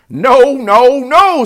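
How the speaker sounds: high, loud goose protest; alarmed goose. A narrator's reading. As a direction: shout a frantic warning as the goose. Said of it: "said the goose".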